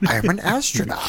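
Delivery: mock astronaut voice. Mocking astronaut quote.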